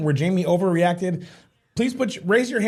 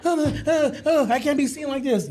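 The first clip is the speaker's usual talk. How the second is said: higher, hammed-up panicky wail; mock panic; 6 semitones higher than usual. Boyfriend mocking girlfriend's oversensitive panic, higher and hammed-up. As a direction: ham up her overdramatic fluster. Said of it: "she was sensitive Nancy".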